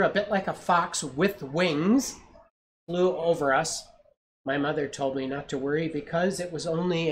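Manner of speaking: gentle read-aloud storytelling; story narration. A narrator's reading. Parent reading a picture book to a child, storytelling voice.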